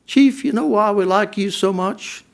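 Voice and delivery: put-on a gentle Southern accent for a fond friend's question. Sweet Alice asks the departing chief, light Southern accent. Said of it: "in that delightful Southern accent".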